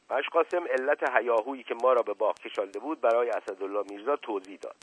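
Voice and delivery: ordinary delivery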